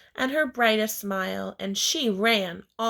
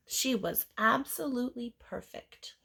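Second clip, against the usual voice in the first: usual speaking voice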